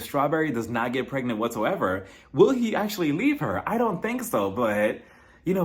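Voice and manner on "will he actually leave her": ordinary talk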